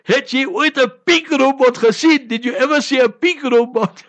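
a cross Afrikaans policeman, lightly voiced, half amused; angry but smiling. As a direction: scold him while grinning. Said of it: "in his anger, but he's smiling also".

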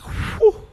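voiceless rush of breath; blown-out whew. Retold or imitated. Man freaking out at himself, blowing out a whew. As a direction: imitate an overwhelmed exhale. Imitates his own freaked-out reaction.

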